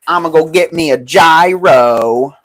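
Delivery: loud, slow hammed-up American, gyro as "jai-ro"; mock American accent. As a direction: ham up a twangy American ordering a "gyro". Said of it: "as the Americans call it".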